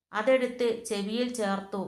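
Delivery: usual speaking voice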